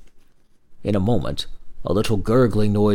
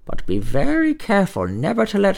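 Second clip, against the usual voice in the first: put-on little old man; 6 semitones higher than usual. Old man advises the brother.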